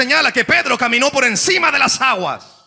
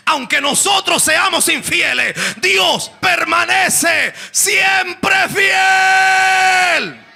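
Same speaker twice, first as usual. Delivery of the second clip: preached refrain; 9 semitones higher, much slower than usual. Acted. Preacher repeats the promise, built up.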